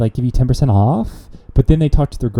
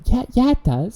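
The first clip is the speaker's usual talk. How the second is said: much higher, airy mocking voice; mocking a friend's voice, pitched way up; mock Blair; 13 semitones higher than usual. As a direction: squeak it out like a teasing caricature of a friend. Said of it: "a character of you".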